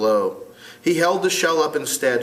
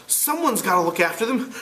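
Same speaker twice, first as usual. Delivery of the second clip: put-on boy voice; 8 semitones higher than usual. Ralph voiced.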